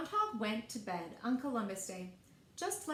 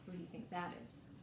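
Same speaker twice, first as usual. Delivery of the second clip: hushed aside; 5 semitones lower, 9 dB quieter, faster than usual. Real, not acted. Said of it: "aside to listening children".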